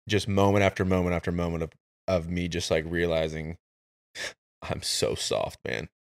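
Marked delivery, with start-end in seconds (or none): 4.2-5.9 quiet sigh. Retold or imitated. Realizing own weakness.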